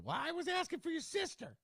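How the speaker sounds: put-on high, strained old lady voice; grandma death voice. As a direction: squeak it like a frail grandma. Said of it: "grandma death voice for your mother".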